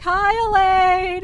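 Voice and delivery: high, loud, drawn-out hello; called greeting. Retold or imitated. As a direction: imitate a friend calling out as she waves. Friend waves and calls a greeting.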